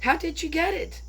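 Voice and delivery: ordinary delivery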